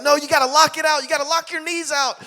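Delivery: high, urgent coaching; pushing coach. Retold or imitated. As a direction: drive the player to finish.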